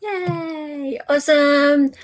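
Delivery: ordinary talk, nothing marked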